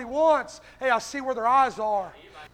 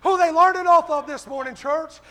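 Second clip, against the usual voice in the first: raised, hard-driving revival delivery; fired-up preacher, punching every phrase; full-force preaching; 3 semitones higher, 6 dB louder than usual. Real, not acted. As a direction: drive each short phrase home to the congregation.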